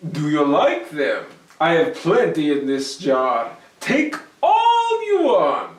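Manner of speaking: loud, rising into a bright offer; Herschel, generous. Acted. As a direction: bait the greedy goblin.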